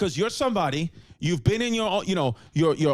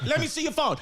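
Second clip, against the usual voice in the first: insistent, higher "let me see your phone"; pushy jealous partner; nagging demand; 7 semitones higher than usual. Retold or imitated. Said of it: "you're like".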